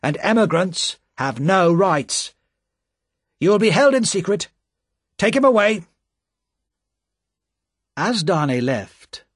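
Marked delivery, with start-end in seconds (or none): 0.0-5.8 high, loud sharp official ordering him away; sharply. A narrator's reading. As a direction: snap the final order. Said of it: "said the official sharply".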